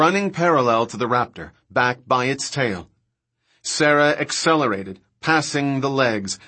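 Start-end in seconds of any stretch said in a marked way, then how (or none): none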